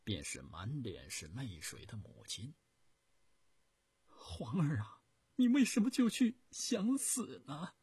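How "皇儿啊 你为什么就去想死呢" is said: high, loud and frantic mother's plea; begging her son in alarm; anxious. Acted. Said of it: "急切地问儿子".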